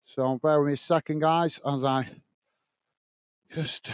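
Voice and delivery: winded, catching breath between words. Real, not acted. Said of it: "oh, catching my breath".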